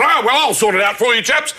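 hammed-up English officer, calling out; retired English colonel. Colonel sorting out the diners' order, hearty bluster. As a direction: take charge as a jovial caricature colonel. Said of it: "this retired English colonel".

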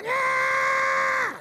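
very high, loud squealing yell; a high, loud dummy cheer; ventriloquist dummy voice. Acted. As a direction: shriek it like a squeaky puppet. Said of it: "no, I do the voice".

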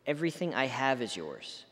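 ordinary talk, nothing marked

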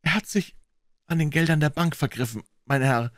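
slightly hoarse, halting confession; a hint of a husky voice. A narrator's reading. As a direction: admit it with a touch of hoarseness. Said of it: "sagte Curtis heiser".

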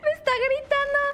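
high, loud squeal; streamer yelling. Real, not acted. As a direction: yell it high like an excited streamer. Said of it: "Está como yo de streamer gritando". (grito agudo de streamer)

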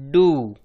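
डू as d plus long oo; blended syllable demo. Shown as a demonstration. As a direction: model डू for learners. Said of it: "तो बन गया".

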